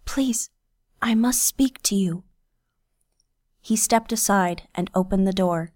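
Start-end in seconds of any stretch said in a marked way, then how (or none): 0.0-2.2 high, breathy pleading whisper; urgent whisper. A narrator's reading. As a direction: beg in a whisper. Urgent plea in darkness.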